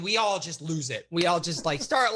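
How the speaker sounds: ordinary talk, nothing marked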